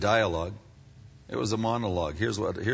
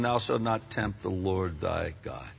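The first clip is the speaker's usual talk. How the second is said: quiet firm rebuke; 11 semitones lower, 9 dB quieter than usual. Retold or imitated. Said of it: "It's also written".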